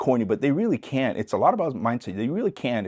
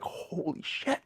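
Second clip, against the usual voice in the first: whispered awe; awed; 8 semitones higher, 9 dB quieter, much slower than usual. Retold or imitated. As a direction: breathe it out in disbelief. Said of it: "Everybody's like".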